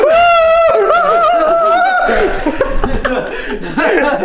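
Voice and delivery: high shrieking laughter. Real, not acted. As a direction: scream with laughter. Scream-laugh at scare.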